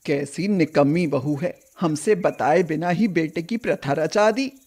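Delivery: a hint of a cranky old matriarch complaining; stern grandmother. Grandmother scolding about her grandson's secret wedding, lightly voiced old woman. Grandmother Padma fumes at home.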